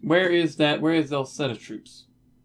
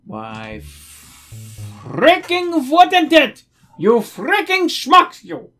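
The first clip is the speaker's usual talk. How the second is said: drawn-out, much higher angry shout; frustrated yell; 13 semitones higher, 12 dB louder, much slower than usual. Real, not acted. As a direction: yell at the dumb units in exasperation. Yelling at dumb game units.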